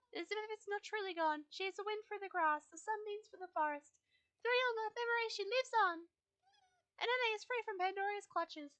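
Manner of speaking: lightly voiced wise guide, comforting; gentle Fripp voice. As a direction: console the grieving rider as Fripp. Player voices Fripp consoling.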